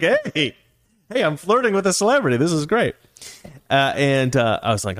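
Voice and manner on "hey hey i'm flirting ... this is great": high-pitched, playful excitement; giddy delight. Retold or imitated. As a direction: gush with mock giddiness. Acting out delight at flirting.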